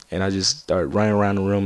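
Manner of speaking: usual speaking voice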